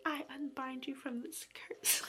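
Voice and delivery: whispered earnest incantation; mock witch whisper; solemn spell. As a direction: cast it softly and seriously. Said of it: "And she's like".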